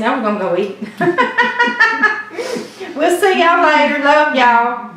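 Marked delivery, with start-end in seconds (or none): 1.0-4.9 louder, higher laughing goodbye. Real, not acted. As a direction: sign off while still laughing. Laughing sign-off to viewers.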